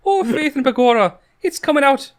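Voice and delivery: put-on high, loud stage Irish accent; hammed-up Irish leprechaun accent. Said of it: "they had a very heavy Irish accent".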